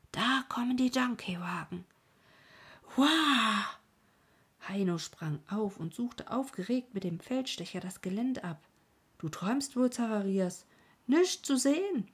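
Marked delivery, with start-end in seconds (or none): 0.1-1.8 urgent whisper; whispered warning. Acted. Servant creeps up to warn his master, whispering. 2.9-3.6 loud, high startled yelp; startled cry. Acted. Boy jolted from his doze yelps loudly. 9.2-12.1 higher and louder, scoffing; doubtful boy. Acted. Boy scoffs at his servant through the field glasses, higher.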